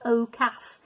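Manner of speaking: usual speaking voice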